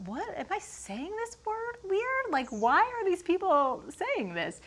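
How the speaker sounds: high, soft mock bewilderment; baffled disbelief. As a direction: squeak it out, retelling your own confusion. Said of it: "And I'm like".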